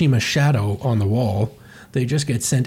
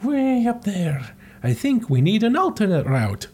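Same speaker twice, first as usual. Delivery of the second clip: nervous Mario; 7 semitones higher than usual. Retold or imitated. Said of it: "Mario starts sweating profusely, going".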